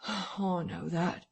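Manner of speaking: soft, slow resigned sigh. A narrator's reading. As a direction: breathe it out defeated. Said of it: "sighed Mister Marvel".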